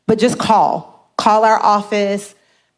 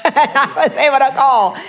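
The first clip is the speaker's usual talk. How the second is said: laughing; 3 semitones higher than usual. Real, not acted. Laughs retelling relief.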